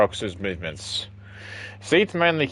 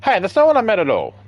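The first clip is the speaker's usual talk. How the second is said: higher, louder flustered denial; embarrassed outburst; flustered protest; 9 semitones higher, 6 dB louder, faster than usual. Acted.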